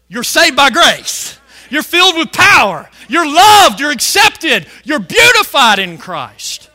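loud, punchy affirmations; forceful preaching. Acted. Preacher telling born-again believers who they are, emphatic. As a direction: declare each phrase to the congregation.

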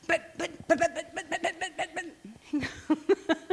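high, laughing stutter of protest; putt-putt the word like a little motorboat; sputtering motorboat. Retold or imitated. Wife objecting to husband's let-it-go, sputtering like a motorboat. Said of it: "Little motorboat just putting up this".

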